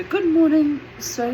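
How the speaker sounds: high, loud, bright little hello; mum's polite greeting. Retold or imitated. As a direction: sing out a polite good morning to a stranger. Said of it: "she says to the gentleman".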